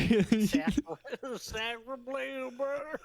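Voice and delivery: high, laughing fake French exclaim; laughing mock French. Friends joking, a laughing high mock French exclamation. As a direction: giggle through a put-on French cry. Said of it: "echoing the French joke".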